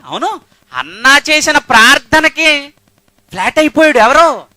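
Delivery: high, loud exclaim; loud, high, excited cry; excited exclamation. Acted. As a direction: burst out in delight. Preacher exclaiming loudly.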